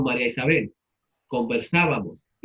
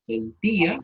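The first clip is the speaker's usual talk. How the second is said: sentía with a higher, stressed í, split from the a; 6 semitones higher, slower than usual. Shown as a demonstration. Teacher repeating the right form for students, sentía stressed on the í. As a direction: raise and hit the í: sen-TÍ-a in sentía. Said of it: "acento en la I".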